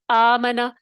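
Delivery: آمنا with the first آ lengthened as a madd letter; recitation demo. Shown as a demonstration. Teacher shows the changed hamza in آمنا, with a long first vowel. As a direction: model آمنا, drawing out the opening آ. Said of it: "حرف مدہ میں بدل دیں گے".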